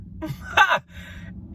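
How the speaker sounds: high-pitched burst of laughter; sudden high cackle; amused cackle. Real, not acted. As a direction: let out a squeal of laughter. Laughing at clown picture.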